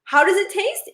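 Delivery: ordinary talk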